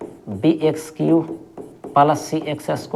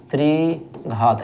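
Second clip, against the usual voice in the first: त्रिघात stretched very slowly, every syllable long; slowly drawn out; much slower than usual. Shown as a demonstration. Teacher to students, slowly drawing out त्रिघात. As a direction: draw out त्रिघात for the class to repeat.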